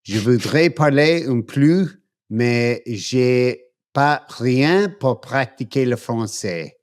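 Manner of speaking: American speaking halting French; careful, effortful French phrases. Real, not acted. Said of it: "I like to say I speak French".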